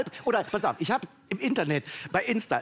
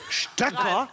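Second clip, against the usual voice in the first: ungläubig; 5 semitones higher than usual. Real, not acted. Empört über falschen Plural.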